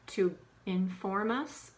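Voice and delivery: ordinary talk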